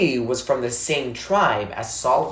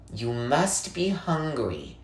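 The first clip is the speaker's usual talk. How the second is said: lightly voiced old woman; 6 dB quieter, slower than usual. Old woman greets hungry boy.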